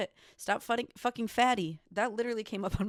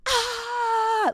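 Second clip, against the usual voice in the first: a piercing high scream, full force; retold scream; 10 dB louder than usual. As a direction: let out a loud high cry. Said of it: "screaming at the top of my lungs".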